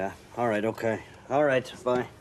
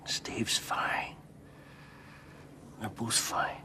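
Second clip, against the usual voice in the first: reassuring whisper; 9 dB quieter, much slower than usual. Acted.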